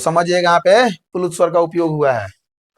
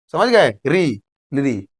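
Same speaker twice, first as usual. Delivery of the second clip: ordinary talk, nothing marked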